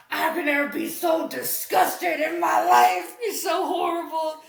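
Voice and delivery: mock shout of disgust; disgusted father. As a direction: play an appalled dad shouting at his daughter.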